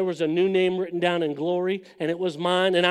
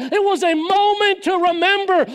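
rising louder and higher to full force; building preaching; 9 semitones higher, 5 dB louder than usual. Acted. Preacher building to a loud climax on his testimony. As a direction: drive the point home to the crowd.

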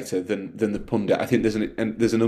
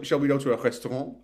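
imitating mum, RESTAURANT in French; English sentence, French accent on "restaurant"; 4 semitones higher, 3 dB quieter than usual. Son imitating his mum's invitation, French accent. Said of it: "she's always just said restaurant in a French accent".

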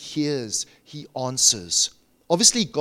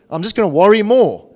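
mock worrier; 5 semitones higher, 11 dB louder than usual. Mock reply to don't worry.

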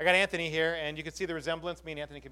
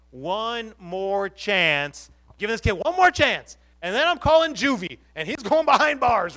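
stern principal warning; 3 semitones higher, 10 dB louder than usual. Acted. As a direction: lay down the last chance loudly. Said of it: "and Pastor Brian says".

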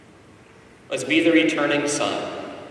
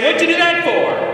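much higher, louder exasperated scold; mock angry father; 5 dB louder than usual. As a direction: scold the wayward son like a fed-up dad. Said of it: "You cuff him upside the head".

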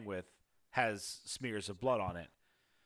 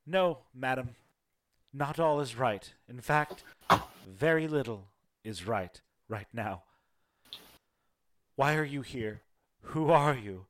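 grieving noblewoman; 2 semitones higher, 6 dB louder than usual. Acted. GM voices grieving half-elf woman.

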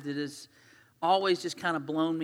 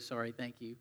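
normal talking voice

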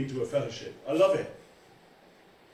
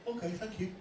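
softer, mild easy agreement; simple acceptance; 5 semitones higher, 6 dB quieter than usual. Retold or imitated. Newcomer takes the teaching without fuss, quiet mock acceptance. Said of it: "They go".

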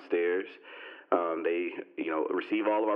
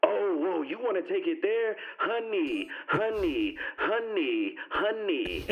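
high, patronizing mimicry of a dismissive woman; man mimics neighbor telling him to calm down, high and patronizing; mocking condescending woman; 9 semitones higher, slower than usual. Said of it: "And then she goes".

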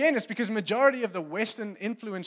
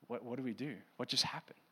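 low, much softer stunned murmur; frightened disciples; 6 semitones lower, 10 dB quieter than usual. Retold or imitated. Frightened disciples in the room, hushed and low. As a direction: barely voice the shock.

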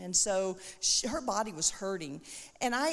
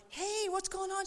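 bubbly greeting; 10 semitones higher, 4 dB quieter than usual. Retold or imitated. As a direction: re-enact an excited hello in a high voice. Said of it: "all bubbly. And, and I'm like".

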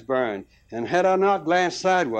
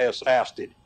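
plain reading voice

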